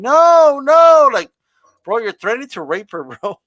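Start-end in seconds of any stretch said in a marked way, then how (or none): 0.0-1.1 loud, high mock screams of no; retold scream. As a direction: yell no like the terrified bride on TV. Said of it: "she's screaming".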